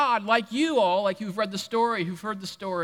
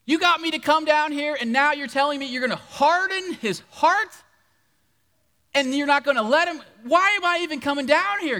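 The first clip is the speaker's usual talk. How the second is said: louder, higher, exasperated complaint; indignant protest; 6 semitones higher, 5 dB louder than usual. Pretend. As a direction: vent at God like a cheated partner. Moses protests to God.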